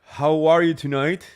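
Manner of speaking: loud, bright hello to fans; excited greeting. Real, not acted. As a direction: greet the viewers with big energy. Show opening greeting to viewers.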